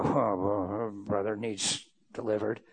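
low, halting put-on reply; mock reluctant verdict. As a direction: drop deep and hesitate over it. Mimics a reluctant counselor's answer.

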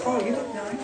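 soft, offhand mock compliment; insincere. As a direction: play a partner giving a half-hearted compliment. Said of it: "didn't say that with too much sincerity".

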